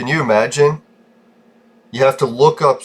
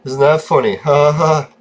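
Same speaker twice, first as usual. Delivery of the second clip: sarcastic mock laugh; 4 semitones higher than usual. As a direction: sneer it as a fake "ha, ha". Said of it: "It's like".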